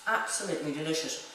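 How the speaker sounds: ordinary talk, nothing marked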